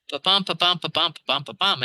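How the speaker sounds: ba-bum said da-DUM, stress on each bum; iambic beat. Shown as a demonstration. Explaining meter to cohosts, chanting the iambic beat. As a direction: tap out "ba-bum" as a steady da-DUM rhythm. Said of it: "in iambic pentameter".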